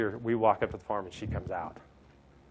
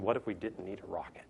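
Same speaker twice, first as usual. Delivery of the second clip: whispered, as if sharing a secret; hushed question; 3 semitones lower, 8 dB quieter than usual. Retold or imitated. Speaker to audience, founders' question whispered. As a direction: breathe the question out like a dare.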